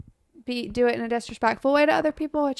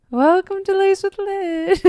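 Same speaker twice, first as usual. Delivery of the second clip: slow, higher sung ghost greeting; 8 semitones higher than usual. Acted. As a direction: croon it like a musical ghost. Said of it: "That was my ghost voice".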